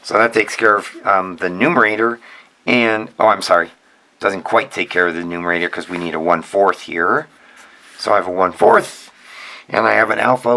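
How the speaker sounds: normal talking voice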